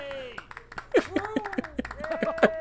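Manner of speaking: a high-pitched giggle; high laugh. Real, not acted. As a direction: break into laughter. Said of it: "laughing at himself".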